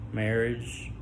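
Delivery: ordinary talk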